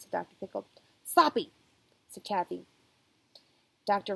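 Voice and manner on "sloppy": louder snapped insult; rude blurt. Acted. Kathy insulting the doctor in word game, loud. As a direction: spit the word out rudely.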